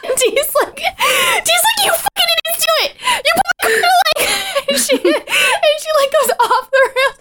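high-pitched, squealing through laughter; helpless laughter. Real, not acted. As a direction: try to talk while cracking up. Retelling through uncontrollable laughter.

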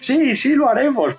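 hammed-up stubborn warrior; Gamora insisting. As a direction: toss it off like a blunt soldier. Gamora insisting in retold scene.

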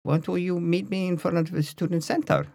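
put-on high-pitched Dutch-accented quote of a girlfriend; imitated Dutch accent, pitched well up. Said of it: "That's an authentic Dutch accent".

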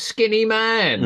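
drawn-out, high, loud mock call; trailer voice. Quoting the trailer line, slow high mock call. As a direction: stretch it slowly up high. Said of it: "she goes".

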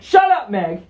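loud, high shout right at her; mock heckling yell. Stranger looks at the actress, loud mock yell. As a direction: holler it like a heckler. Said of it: "look at her and be like".

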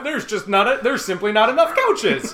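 giggling at the couch joke; laughing. Real, not acted. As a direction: laugh out the punchline.

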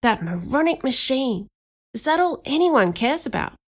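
high, indignant hiss. A narrator's reading. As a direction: hiss it crossly at a friend. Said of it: "I hissed into Danny's ear".

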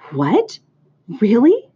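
eager, disbelieving little exclamation; mild cry. A narrator's reading. Pig hears spider say he shall not die, cried. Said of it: "cried Wilbur".

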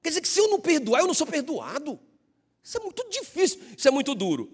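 high, pleading complaint to the Spirit; mock grievance, voice lifted high; complaining prayer. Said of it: "falando assim".

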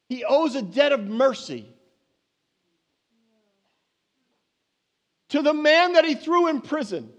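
high, loud, impassioned preaching; preaching at full force. Acted. Preacher pressing the church on mercy, full force. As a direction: drive it home to the congregation.

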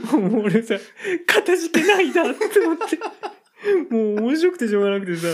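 can barely speak for laughing; cracking up. Real, not acted.

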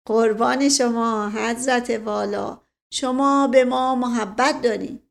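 ordinary delivery, nothing marked

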